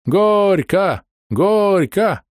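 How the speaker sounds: high, drawn-out wedding chant; crowd shout. A narrator's reading. Guests urge the couple to kiss, chanting high. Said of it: "закричали со всех сторон".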